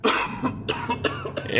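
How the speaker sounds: harsh breathy coughing; violent coughing fit. Acted. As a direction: cough hard and wet. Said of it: "he started coughing violently".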